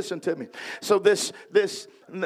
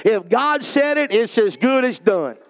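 raised, emphatic preacher's proclamation; full-force preaching; 3 semitones higher, 4 dB louder than usual. Acted. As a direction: drive it home to the congregation.

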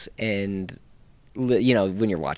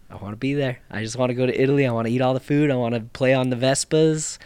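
eager wish list, climbing higher and louder; building excitement; 4 semitones higher, 5 dB louder than usual. Real, not acted. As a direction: gush with mounting enthusiasm, ending high and loud. Gushing list of wishes about Italy.

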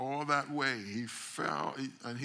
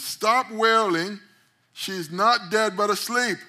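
commanding shout; 9 semitones higher, 12 dB louder, slower than usual. Acted. Jesus commands the mourners.

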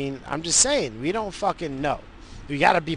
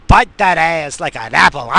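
loud, higher, cheeky blurt; loud outburst; 4 semitones higher, 11 dB louder than usual. Real, not acted. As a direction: shout it out for a laugh. Crude outburst while driving.

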